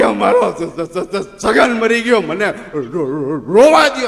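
comic crying mourner; mock sobbing stammer. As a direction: stammer through fake tears.

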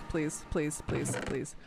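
low, soft repeated pleas; begging. Real, not acted. Player begging the devs to change it back, pleading. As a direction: plead under your breath, word after word. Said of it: "I beg of the devs".

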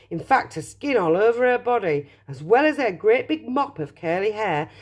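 plain reading voice

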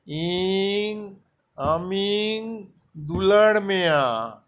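আমিং stretched out slowly, then দুলোর Mia said firmly; teacher repeating the phrase slowly for memorising; slow Santali drill. Shown as a demonstration. Teacher has learners repeat the Santali sentence, slow. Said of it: "তাহলে হচ্ছে দেখুন".

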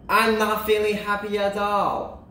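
high, sustained complaining character voice; unhappy Kansas. Acted. Kansas announces his misery to everyone, high and whiny. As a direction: complain in a high, unchanging pitch. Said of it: "Because he says".

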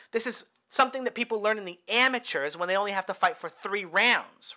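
ordinary delivery

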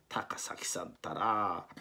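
slow, whispered grumble; whispered mutter. Acted. Bruton holding his nose, a slow whispered grumble. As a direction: breathe it out with a pinched nose. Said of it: "そうつぶやきました".

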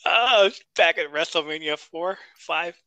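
ordinary talk

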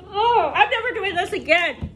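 ordinary talk

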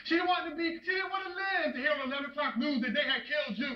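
anguished tirade. Acted. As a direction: pour out grief as fury at your son. Acted father berating condemned son.

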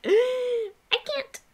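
high, pleading "I can't" after a gasp; whiny protest. Retold or imitated. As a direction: beg like you can't stop yourself. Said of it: "I was like".

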